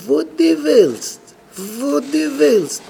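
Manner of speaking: high, very drawn-out falling cry; emphatic insistence. Real, not acted. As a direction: stretch WILLST high and slow, then let it fall. Insisting nothing whatever will help.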